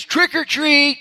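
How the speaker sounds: put-on high, loud little boy yelling trick or treat; excited kid shout. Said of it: "he then shouted the magic phrase".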